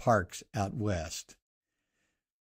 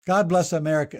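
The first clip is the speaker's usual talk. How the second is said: higher and louder, proclaimed God bless America; ringing benediction to the listeners; proclaiming blessing; 6 semitones higher, 6 dB louder, faster than usual. Real, not acted. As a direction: lift God bless America up like a rallying cry. Renewed blessing, raised and proclaimed.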